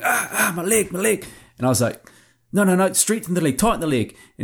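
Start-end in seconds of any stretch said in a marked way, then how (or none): 0.0-1.3 high, strained cry of leg cramp pain. Retold or imitated. 2.5-4.1 urgent. Retold or imitated. Urgent fix during tense hunt.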